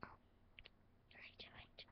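secretive whisper; quiet and secretive. Acted. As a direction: lean in and whisper the question. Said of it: "all quiet and secretive".

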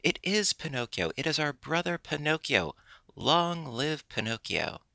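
high-pitched cheer at normal volume; mock shout of joy, lifted in pitch; excited shouting. A narrator's reading. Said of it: "shouted all the puppets in chorus".

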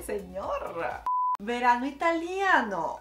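ordinary talk, nothing marked

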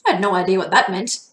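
ordinary delivery, nothing marked

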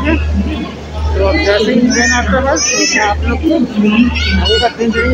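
muffled by face covering; speaking behind fabric mask; through a cloth mask. Real, not acted. Seen 4: Grey cloth mask covers mouth and nose throughout the labelled words.

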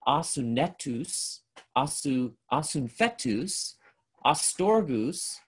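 slow, deliberate Greek word list, each word set apart; Greek sound demonstration. Shown as a demonstration. Teacher reading Greek words to show a repeated sound, slow and deliberate. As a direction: sound out "Asunetus, asunfetus, astorgus" slowly so the repeated opening A rings. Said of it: "you're gonna hear the, uh, a particular repeated sound".

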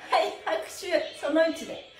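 ordinary delivery

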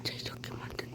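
soft unvoiced whisper. Real, not acted. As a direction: whisper while working. Whispering while building.

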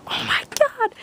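breathless high whispered "oh my god"; whispered shock at spotting the toy; amazed gasp. Retold or imitated. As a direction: gasp it out in stunned delight. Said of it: "and I was like".

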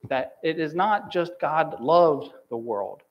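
ordinary talk

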